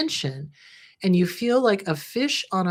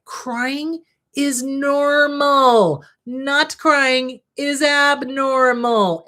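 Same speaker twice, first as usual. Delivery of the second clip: CRYING IS NORMAL stretched slow, high and loud; emphatic declaration; 5 semitones higher, 5 dB louder, much slower than usual. Acted. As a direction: proclaim it slowly, word by word, with force. Emphatic self-talk affirmation to viewers.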